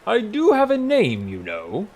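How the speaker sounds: high and loud, dropping away at the end; indignant protest. A narrator's reading. Boss objecting to being called human, indignant. Startled Hugor objects to god.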